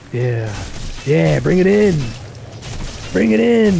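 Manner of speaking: hyped challenge to the game monsters; taunting call. Real, not acted. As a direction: egg them on, bring it.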